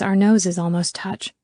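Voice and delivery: ordinary delivery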